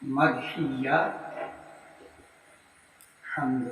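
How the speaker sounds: slow, soft word-by-word dictation with pauses. Shown as a demonstration. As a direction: dictate each phrase for students to write down. Dictates second answer. (धीमे-धीमे, रुक-रुक कर लिखवाना)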